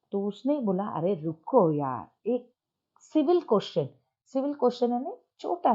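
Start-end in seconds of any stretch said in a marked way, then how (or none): 0.9-3.9 gentle request. Shown as a demonstration. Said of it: "प्यार से पूछ रहा हूँ".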